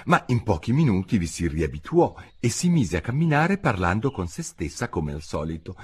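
plain reading voice

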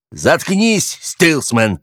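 high angry shout of shut up; growled warning. A narrator's reading. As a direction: bark the order threateningly. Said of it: "угрожающе прорычал Гарри". (угрожающий рык)

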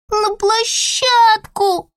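put-on high, slow whining toddler; whiny little sibling. A narrator's reading. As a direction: whine like a little kid. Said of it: "захныкала Рути". (капризное детское нытьё)

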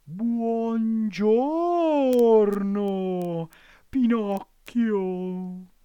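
high, slow, sugary fox voice; drawn-out courtly greeting from a cunning fox; polite sly fox. A narrator's reading. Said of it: "salutandolo garbatamente".

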